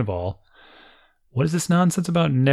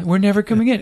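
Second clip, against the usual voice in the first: higher, louder teasing singsong; mock taunt; 9 semitones higher, 5 dB louder, faster than usual. [name] echoing the girls' radio taunt, mock teasing. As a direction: tease like a defiant kid.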